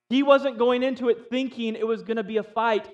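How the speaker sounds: usual speaking voice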